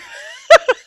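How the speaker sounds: high squealing burst of laughter; shrieking laugh. Real, not acted. Host cracking up after her song.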